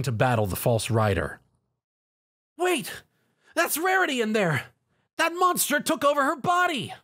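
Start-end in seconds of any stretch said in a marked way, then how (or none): 2.6-6.9 high, urgent shouted warning; Spike shouting. Acted. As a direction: yell it out, panicked for a friend. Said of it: "Spike shouted".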